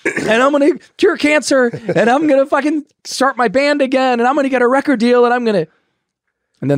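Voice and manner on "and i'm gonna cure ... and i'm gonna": much higher, loud and fast, giddy mock rant; manic pink-cloud euphoria. Newly sober man boasting his plans, giddy and high-pitched. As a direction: gush like a newly sober man on top of the world. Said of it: "you get the pink cloud".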